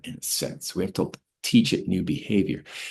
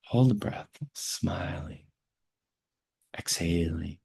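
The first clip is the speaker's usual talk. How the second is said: calm teacher guiding a breathing exercise; guided breathwork. Acted. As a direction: lead them gently through each breath.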